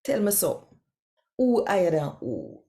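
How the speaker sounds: usual speaking voice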